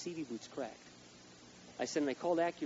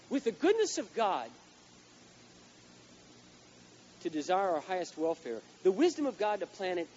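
preaching quote; 4 semitones higher, 4 dB louder than usual. Acted. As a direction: proclaim it from the pulpit.

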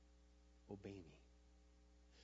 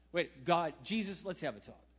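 bold call; 5 semitones higher, 11 dB louder than usual. Retold or imitated. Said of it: "Peter, he goes".

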